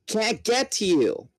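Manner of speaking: the narrator yells to a trapped friend; yelling. Acted. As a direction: shout it across the chaos. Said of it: "I yelled".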